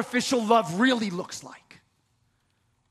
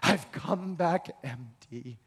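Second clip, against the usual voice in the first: low, slow, hollow; 10 semitones lower, 7 dB quieter, slower than usual. Retold or imitated. Naomi returning home, said low and slow. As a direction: lower and slower, emptied out.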